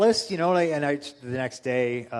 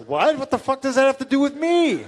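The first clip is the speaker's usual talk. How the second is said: high, loud incredulous outburst; 10 semitones higher, 7 dB louder than usual. Retold or imitated. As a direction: shout it in laughing disbelief. Reacting to absurd news.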